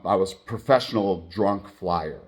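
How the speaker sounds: ordinary delivery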